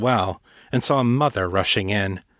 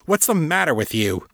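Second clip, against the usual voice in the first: higher, demanding father's rebuke; stern demand, pitch raised; 6 semitones higher than usual. A narrator's reading. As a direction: demand an answer from the boy. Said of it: "Father demanded".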